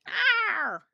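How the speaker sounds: high, loud mock eagle screech; eagle cry imitation. Showing viewers what eagles sound like, with a high loud cry. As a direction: let out a piercing mock cry. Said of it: "Eagles sound like".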